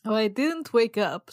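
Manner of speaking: ordinary talk, nothing marked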